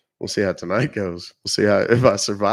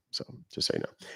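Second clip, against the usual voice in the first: whispered aside; 11 dB quieter, faster than usual. Real, not acted. Aside after trademark joke.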